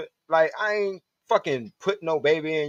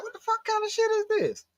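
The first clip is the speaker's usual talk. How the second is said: much higher, exasperated disbelief; mock outrage; 4 dB quieter than usual. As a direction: shriek it at the glued button. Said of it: "I'm like".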